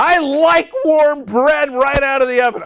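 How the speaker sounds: high, loud, over-the-top cheer; exaggerated enthusiasm. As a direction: belt it out with hammed-up delight. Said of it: "Say it with enthusiasm".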